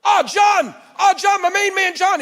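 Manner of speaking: high, loud shout of joy; excited greeting. Retold or imitated. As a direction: call his name across the hall, thrilled. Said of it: "He gets excited".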